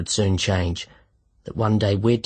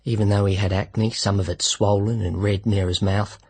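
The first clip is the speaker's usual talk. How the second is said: ordinary delivery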